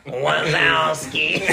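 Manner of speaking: drawn-out slimy slug voice; very slow slimy snail voice. Acted. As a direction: stretch the name out like a slug. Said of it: "A little bit, right?".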